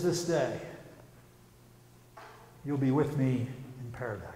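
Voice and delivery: low, hushed, halting dying words; barely able to talk. Retold or imitated. Dying Jesus promises paradise to the thief, barely able to talk. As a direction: imitate a dying man's faint, effortful speech. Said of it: "he can barely talk in this garbled voice".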